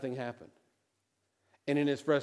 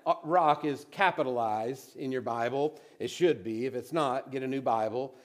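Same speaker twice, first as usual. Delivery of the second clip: normal talking voice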